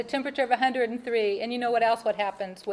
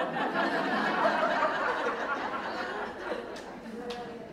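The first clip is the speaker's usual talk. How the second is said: breathy laugh; 8 semitones higher, 6 dB quieter than usual. Real, not acted. Laugh after husband's trunk joke.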